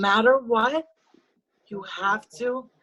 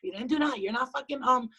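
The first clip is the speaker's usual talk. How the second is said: usual speaking voice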